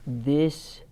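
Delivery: slow, soft storybook reading; storybook voice for little listeners; reading to children. Acted. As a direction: read it gently to a class of small children. Reads picture book aloud.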